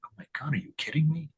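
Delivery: quick, high, half-whispered disbelief; soft retold gasp of wonder; hushed amazement. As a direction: recall being astonished, fast and under your breath. Recalls own amazed reaction while reading.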